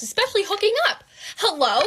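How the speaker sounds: high, loud outraged outburst; indignant telling-off. Retold or imitated. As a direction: scold them in acted disbelief. Acted telling-off over FaceTime.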